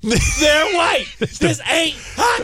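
very high, shouted coach parody; shouted movie impression. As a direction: holler the film lines, drawing out the end. Acting out a coach's film speech.

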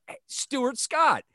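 high, drawn-out mock hail; retold stranger's greeting. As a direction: call it out like an excited passer-by. Voicing a stranger mistaking his name.

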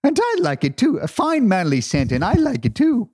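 hammed-up Irish accent from an old soap ad; Irish Spring ad. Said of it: "remember the old Irish Spring commercial".

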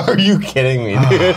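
incredulous, through laughter; laughing disbelief. Real, not acted. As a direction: crack up while asking it. Cracking up after a prank call.

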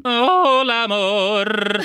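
squeaky high-pitched hola amor; voice cracking up an octave. Retold or imitated. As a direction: let the voice jump way up and crack. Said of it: "My voice cracked when I said".

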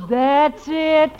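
usual speaking voice

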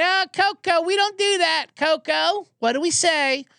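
high sing-song mock owner; mock owner scolding. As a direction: chide a dog like a toddler, high and sweet. Mocking an owner scolding her dog.

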